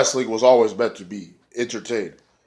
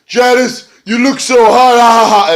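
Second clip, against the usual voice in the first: much higher, louder, theatrical voice with a mock evil laugh; villain taunt; 11 semitones higher, 9 dB louder than usual. Matt Hardy gloating over the flaming Janice, high hammy cackle. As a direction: cackle at the burning weapon like a mad showman.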